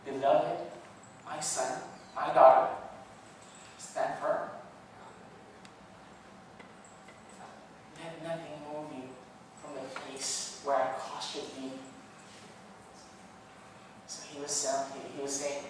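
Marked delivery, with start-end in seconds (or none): 0.0-11.7 softer, gentle fatherly reassurance; tender voice of God. Acted. God speaking softly to his son, tender and reassuring. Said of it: "the last part it says".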